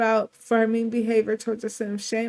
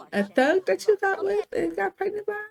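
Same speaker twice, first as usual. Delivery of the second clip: mom voice; 8 semitones higher than usual. Acted. Mother voiced berating daughter.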